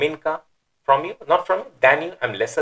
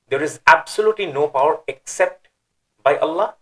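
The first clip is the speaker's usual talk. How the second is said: absolutely leaned on, the whole line a little louder; emphasis on absolutely; 4 semitones higher, 4 dB louder than usual. Shown as a demonstration. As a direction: press hard on absolutely, no power but by Allah. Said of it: "understood the emphasis now".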